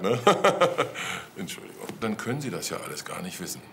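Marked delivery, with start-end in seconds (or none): 0.2-1.3 short burst of laughter, louder and higher; amused laugh at the count's expense. Real, not acted. Officer laughs at late adoption. 1.4-1.9 much softer, breathed sorry; sheepish soft apology after laughing; whispered apology. Real, not acted. Apology right after laughing aloud.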